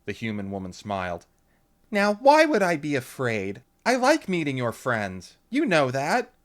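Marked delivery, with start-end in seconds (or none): 1.9-3.6 high, loud cheerful mom; breezy mother brushing off worry; bright mom voice; Lauren's mother. A narrator's reading. 3.8-5.4 high, loud cheerful mom; breezy mother brushing off worry; bright mom voice; Lauren's mother. A narrator's reading. Mother repeats same line. 5.5-6.3 high, loud cheerful mom; breezy mother brushing off worry; bright mom voice; Lauren's mother. A narrator's reading.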